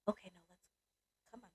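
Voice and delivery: very soft imitated coaxing; hushed retold coaxing. Work husband getting her out of the parking lot, hushed. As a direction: quote him gently under your breath.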